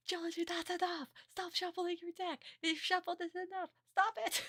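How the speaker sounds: high frantic put-on yell; panicky Isuno. Isuno panicking, yelling at Jonochi to stop shuffling, high. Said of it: "Isuno comes in and be like".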